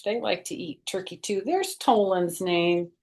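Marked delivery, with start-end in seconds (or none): none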